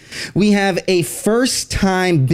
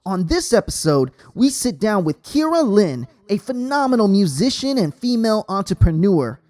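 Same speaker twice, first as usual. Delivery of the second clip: podcast intro read; 6 semitones higher than usual. Acted. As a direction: read it like a polished show intro, unhurried. Host reads show intro.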